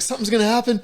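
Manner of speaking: high voice breaking into laughter; mock-panicky warning through laughs; overwhelmed Dale imitation. Dale warning he is about to climax, mock panic. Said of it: "he's like, he's like".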